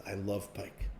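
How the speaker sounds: soft, low, content; smiling king. Acted. King in the kitchen delighting in his pike, soft and low. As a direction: savour the thought of dinner. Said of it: "the king puts up a beautiful big smile and says".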